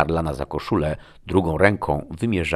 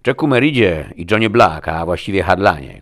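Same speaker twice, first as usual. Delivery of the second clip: loud, raised formal address; official proclamation; 4 semitones higher, 9 dB louder than usual. A narrator's reading. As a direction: announce names with forceful authority. Agent begins announcing an arrest.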